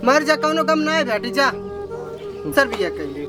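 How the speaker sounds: ordinary delivery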